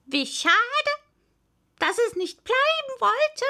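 high, loud regretful parrot voice; sighing parrot. A narrator's reading. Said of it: "seufzte der Papagei".

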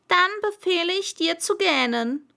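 high, loud royal order; pompous king's decree; commanding king. Acted. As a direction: forbid it with full authority.

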